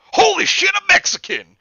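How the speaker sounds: very high, loud shocked yell. Acted. Officer yells on seeing Leo, very high and loud. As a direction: yell in outraged alarm. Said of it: "the officer yelled".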